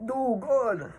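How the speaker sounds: high, sharp yelp of pain; mock pained cry. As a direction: squeal like you just got hit in the groin. Michael hit in the nuts.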